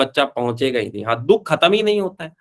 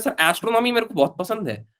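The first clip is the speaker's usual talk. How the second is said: lightly voiced student, pitched higher; retold eager student; 6 semitones higher than usual. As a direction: quote a student announcing a favourite subject, higher. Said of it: "एक बच्चा बोल रहा है". (छात्र की हल्की नकल, ऊँचे सुर में)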